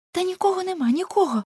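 high, anxious denial; frightened denial. A narrator's reading. Girl lying to stop Ulenspiegel, scared. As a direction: hurriedly insist no one is there. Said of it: "сказала Неле".

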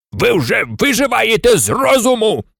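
mild mock shout of a station master. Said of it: "крикнув вождю індіанців начальник вокзалу".

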